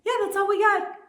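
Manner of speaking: put-on high, loud girlish reply; sheepish chirp. Singers answering the producer, high-pitched chirp. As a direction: pipe it up high.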